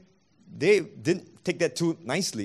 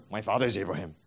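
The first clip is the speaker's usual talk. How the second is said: lower, softer smug My father is Abraham; mock stock excuse; 8 semitones lower, 4 dB quieter, faster than usual. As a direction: toss off the excuse as if it ends the matter. Mocking their stock excuse.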